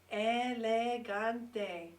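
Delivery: Elegante slowed into four clear syllables, e-le-gan-te. Shown as a demonstration. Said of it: "That's four syllables".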